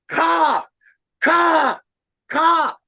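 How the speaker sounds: high, loud crow caws; three sharp cawing calls; imitated crow caw. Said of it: "all of a sudden I hear this".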